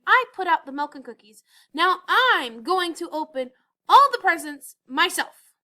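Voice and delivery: loud, building furious list; angry rant. A narrator's reading. Elf scolding the friends who never helped, loud. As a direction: lay down the law.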